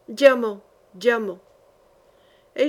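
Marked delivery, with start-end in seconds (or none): 0.1-0.6 furious cry. Acted. Holy fool raging at the old enemy, a loud furious cry. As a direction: spit out the word in rage.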